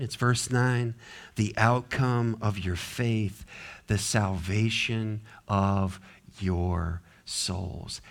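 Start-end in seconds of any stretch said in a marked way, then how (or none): 1.3-8.0 slow, low, hushed scripture reading; soft and weighty, with pauses between phrases; deliberate rereading. Shown as a demonstration. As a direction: read it again slowly, letting each phrase sink in. Verse reread slowly for weight.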